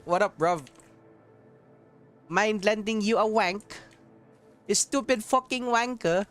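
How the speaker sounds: put-on British accent. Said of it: "why can't they speak British".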